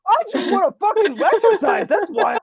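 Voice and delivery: high, loud exasperated retort; mock angry shout. Fed-up rider to Peloton instructor, mock angry shouting. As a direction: yell back in comic outrage.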